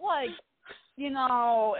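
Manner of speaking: normal talking voice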